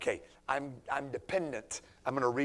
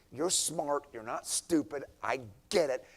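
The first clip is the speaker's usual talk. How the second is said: normal talking voice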